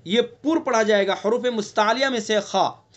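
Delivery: usual speaking voice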